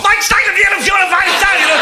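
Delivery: high-pitched shouted tirade; Hitler. Acted. Comic acting out a hungover dictator back to his old self, high shouted rant. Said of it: "Back to the old Hitler you lot know and love".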